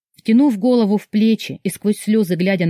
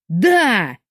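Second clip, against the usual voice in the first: barked answer. A narrator's reading. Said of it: "заорал Мурат в трубку".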